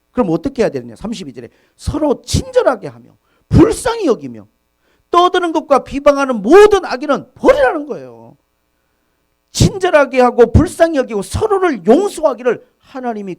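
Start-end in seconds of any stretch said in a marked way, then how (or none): 1.7-13.4 much higher and louder, preaching at full force; impassioned preacher reading the Bible aloud; fervent scripture reading. Acted. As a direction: proclaim the verse to the whole congregation.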